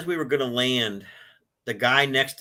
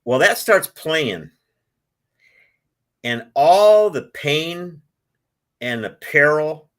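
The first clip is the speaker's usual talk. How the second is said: emotional; 4 semitones higher, 8 dB louder, slower than usual. Real, not acted. Telling the interviewer of his breakdown, slow and emotional. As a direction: let the grief rise as the song starts.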